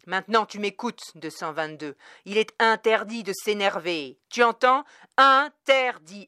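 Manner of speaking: very loud, angry scolding building to a shout; heated father. A narrator's reading. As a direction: bellow the order at your child, dragging out the final word. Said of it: "qui voyait le père s'échauffer".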